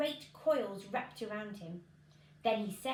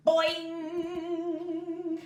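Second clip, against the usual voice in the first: high, loud cartoon boing; spring the word like a catapult; boing of the beanstalk snapping upright; springy boing; 7 semitones higher, 8 dB louder than usual. A narrator's reading. Sound effect in story.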